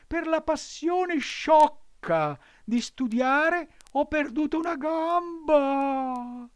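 high, loud, slow fox voice; a hammed-up warning; sly fox. A narrator's reading. Fox warns against studying.

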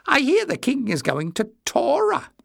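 high-pitched, mischievous needling. A narrator's reading. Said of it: "his face alight with mischief".

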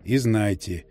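rustling, colourless voice, low and slow. Acted. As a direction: let it rustle out, toneless. Blink's possessed farewell. (шелестящий бесцветный голос)

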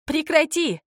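high-pitched demand to stop; woman's cry. A narrator's reading. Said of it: "она сразу перешла на крик".

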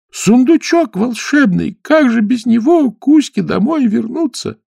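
high, slow, pleading mermaid. Acted. (голос русалки)

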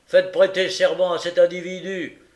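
loud, high, grudging order; grudging grumble. Acted. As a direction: bark it out unwillingly. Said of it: "grommela Fang de mauvaise grâce".

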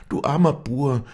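keening, rising above the first cry. A narrator's reading. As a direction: let the grief rise further. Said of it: "So klagte sie laut".

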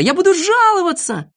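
high, loud threat to complain; indignant yell. A narrator's reading. Boy dragged out threatens to complain, yelling. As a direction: shriek it in outrage. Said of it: "кричал Незнайка".

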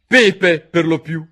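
loud, high-pitched answer; curt cook. A narrator's reading. Cook answers the king in court, loud and curt.

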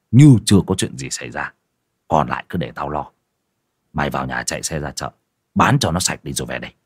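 low, gruff, secretive orders; domineering husband. A narrator's reading. Husband figure orders wife.

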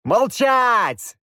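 loud, high furious shout; yell at the top of lungs. Acted. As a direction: bark it to silence the kids. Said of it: "заорал я во всю глотку". (громкий яростный крик)

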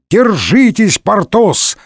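high, urgent call. A narrator's reading. D'Artagnan encourages drowning Porthos, calling out. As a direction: call across to someone in danger. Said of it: "крикнул Д'Артаньян".

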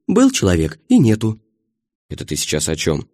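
usual speaking voice

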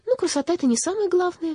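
put-on high, wistful little-girl sigh; high-voiced gentle sighing. A narrator's reading. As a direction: say it on a sigh, pitched well up. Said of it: "вздохнула Лили".

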